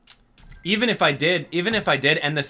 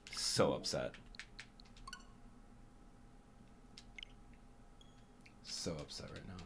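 dejected murmur; 10 semitones lower, 21 dB quieter than usual. Real, not acted. Streamer dejected after failure.

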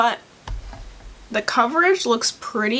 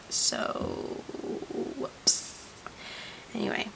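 whisper; 13 dB quieter, much slower than usual. Real, not acted. Sheepish aside after deleting footage.

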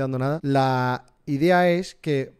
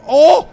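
high, very loud "oh"; sudden high yelp; startled shout; 13 semitones higher, 16 dB louder than usual. Real, not acted. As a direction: burst out with a shocked yell.

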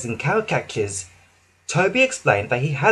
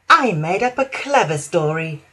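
smug chuckle; 3 semitones higher than usual. Acted. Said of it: "she chuckled to herself".

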